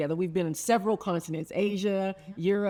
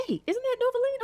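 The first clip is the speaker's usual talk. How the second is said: very high-pitched mock call of recognition; imitated husband. Husband pointing out a friend outside restaurant, high imitated exclamation. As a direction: act out a husband spotting someone, voice way up.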